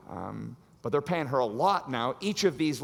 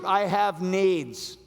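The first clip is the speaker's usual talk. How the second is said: drawn out very slowly, louder; slow stubborn excuse; 4 semitones higher, 5 dB louder, much slower than usual. Pretend. Samson refusing the warnings, slow and stubborn. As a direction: stretch each word, digging in.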